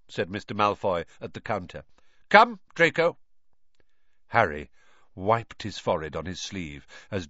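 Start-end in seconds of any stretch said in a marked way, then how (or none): 2.3-3.2 louder, higher call to the son; commanding. A narrator's reading. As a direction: summon the boy briskly. Father summons son.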